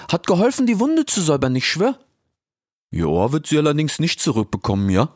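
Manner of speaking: high, cheeky youth voice; Ilias. Acted. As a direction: call out playfully, very high. (frecher Ilias, hoch und laut gerufen)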